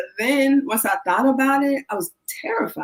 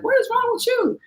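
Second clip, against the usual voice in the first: imitating dad's concern. Stoic dad notices scared daughter.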